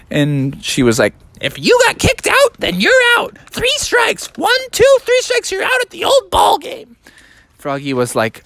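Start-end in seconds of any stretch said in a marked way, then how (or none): 1.4-7.0 very high-pitched put-on voice; scolding mom. Mom to Froggy about being kicked out, high-pitched scolding. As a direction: lay down the law in falsetto.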